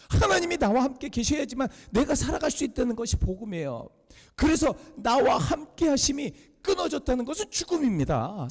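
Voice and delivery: high, urgent preaching voice; impassioned preaching. Acted. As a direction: proclaim good news with rising fervour. Preaches at full force. (높은 음으로 열정적으로 선포하는 설교조)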